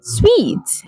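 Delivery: "Sweet" with w glide and long ee; high, falling model "Sweet"; correct pronunciation. Shown as a demonstration. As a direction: say suite exactly like "Sweet". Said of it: "so not suit".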